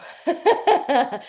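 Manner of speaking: a short, real laugh, out of breath; breathless laugh. Real, not acted. Coach laughs at being out of breath, breathless laugh. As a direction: laugh it off while panting.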